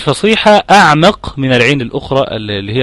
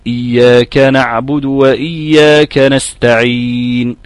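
Quran recitation demo; 2 semitones lower, much slower than usual. Shown as a demonstration. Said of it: "اسمع كلامي".

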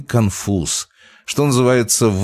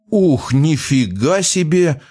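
surprised exclamation; 8 semitones higher, slower than usual. A narrator's reading. Exclaimed over the speakers.

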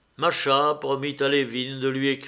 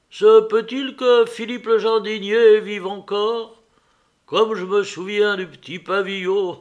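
higher, louder, eager questions; eager excitement; 6 semitones higher, 7 dB louder than usual. A narrator's reading. Nicolas begs his brother for news of home, excitedly. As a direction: gush with eager memories of home.

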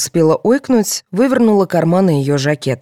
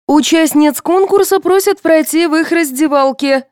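high, loud, monotone announcement; wheezy radio voice; 13 semitones higher, 6 dB louder than usual. A narrator's reading. Radio calling contestants to dressing room, wheezy announcement. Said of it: "просипело радио".

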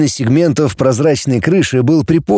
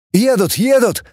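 calling from the dark; 10 semitones higher than usual. A narrator's reading. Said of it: "донёсся чей-то голос из темноты".

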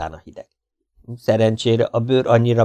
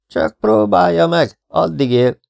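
fenyegető; 8 semitones higher, 5 dB louder, slower than usual. A narrator's reading. Threat in an acted quarrel.

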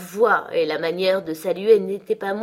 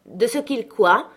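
higher, louder, drawn-out question; troubled exclamation; 4 semitones higher, 5 dB louder, slower than usual. A narrator's reading. Girl challenges boy's unfinished complaint, exclaiming, troubled. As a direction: cry out, taken aback. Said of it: "s'écria Catherine".

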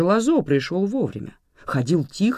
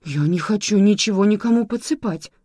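a touch of fright, higher; frightened; 8 semitones higher, 4 dB louder than usual. Acted. Lola scared by Sergo's idea of poisoning, frightened. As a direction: pull back in alarm. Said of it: "испугалась Лола".

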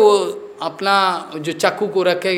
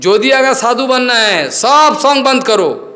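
stern exhortation; 5 semitones higher, 4 dB louder than usual. Real, not acted. Preacher commands would-be monks.

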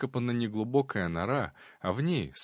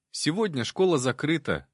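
put-on higher, light boy voice; carefree boy; 6 semitones higher, 3 dB louder than usual. A narrator's reading. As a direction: answer breezily like a schoolboy. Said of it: "беззаботно ответил паренёк".

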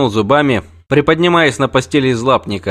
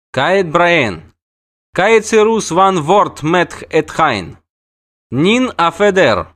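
high, slow call in a strange tongue; a forest dryad calling a greeting across the clearing; dryad hailing; 7 semitones higher, 3 dB louder, much slower than usual. Acted. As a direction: sing out the Elder Speech greeting, drawn out. Dryad calling in Elder Speech.

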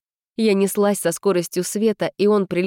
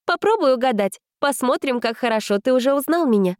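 flirtatious; 6 semitones higher than usual. A narrator's reading. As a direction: flirt with a playful challenge. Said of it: "кокетливо сказала я".

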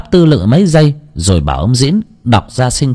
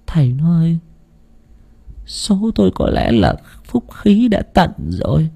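faint dying plea. A narrator's reading. Dying wife begs her husband to raise their child, faint plea. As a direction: plead weakly with your last breath. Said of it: "thều thào căn dặn".

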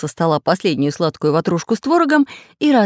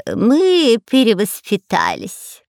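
slow, higher, syrupy spy voice; sugary lying; 5 semitones higher, much slower than usual. A narrator's reading. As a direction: draw it out sweetly and falsely. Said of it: "сладким голосом соврал шпион Дырка".

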